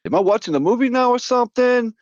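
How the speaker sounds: squeaky high cartoon voice, all the way up; high Smurf voice. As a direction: play the startled guy in a chipmunk squeak. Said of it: "I heard that voice".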